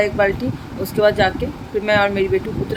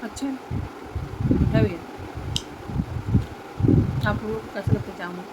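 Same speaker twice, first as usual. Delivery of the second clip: hushed; 2 semitones lower, 10 dB quieter than usual. Real, not acted. Talking to viewers beside sleeping daughter, very soft hushed voice. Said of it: "धीरे धीरे बोलती नहीं तो उठ जाएगी".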